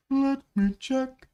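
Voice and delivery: high, loud and slow; drawn-out call. Pretend. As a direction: stretch each word out in a high call. Repeats it loudly, drawn out.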